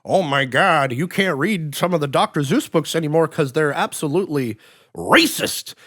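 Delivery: exaggerated scandalized alarm, rising on the last word; mock outraged news voice. Mocking the news about cancelled books, mock outrage. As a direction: imitate overblown outrage at a scandal. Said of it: "a few years ago on the news, like".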